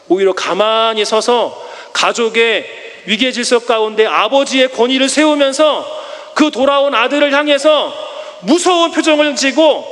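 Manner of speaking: ordinary talk, nothing marked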